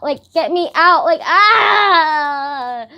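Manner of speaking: high, loud plea ending in a wail; hammed-up cry to be rescued; mock panic. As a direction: build to a long exasperated scream. Acts wish to escape strangers.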